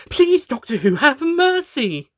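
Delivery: high, slow desperate plea; the Rani pleading with the furious doctor; begging. Acted. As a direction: beg for mercy. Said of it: "the Rani begged".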